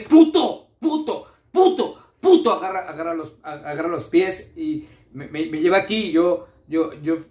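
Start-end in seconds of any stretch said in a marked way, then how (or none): 0.0-2.5 sudden high-pitched yell; shouted curse. Acted. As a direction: blurt the swear word out loud. Acted outburst in a stammered tale.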